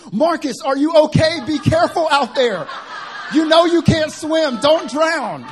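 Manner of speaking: high, loud panicked mom; all caps shouting. Retold or imitated. Son acting out mom's all-caps comment as a high loud panicked shout. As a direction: yell it like a worried mom in capitals. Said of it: "she writes in all capital letters".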